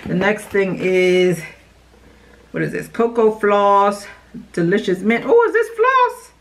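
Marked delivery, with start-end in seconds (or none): 5.2-6.3 high surprised squeak; startled delight at the item. Real, not acted.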